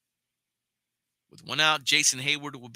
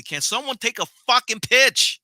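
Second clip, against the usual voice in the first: louder, higher angry outburst; frustrated outburst; 10 semitones higher, 10 dB louder than usual. Real, not acted. Fed-up fan yelling at Dodgers hitters, real angry outburst. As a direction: vent it at the batters. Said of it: "I'm getting frustrated here already".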